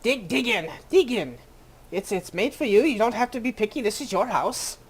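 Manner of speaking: lightly voiced busy diner cook; bustling cook. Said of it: "Without even acknowledging that you sat down".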